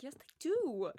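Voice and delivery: loud, high rebuttal, DO punched; emphatic insistence. Retold or imitated. She contradicts him over salt, retold emphatic insistence. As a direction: hammer home the comeback.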